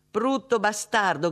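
high, loud, drawn-out insult; angry shout. A narrator's reading. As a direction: shout abuse down the receiver. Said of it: "gridò Anne nella cornetta". (grido di rabbia)

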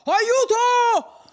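high, loud scream for help; wild cry for help. A narrator's reading. Don Abbondio crying out into the silent night, wild shout. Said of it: "quel primo sgangherato grido".

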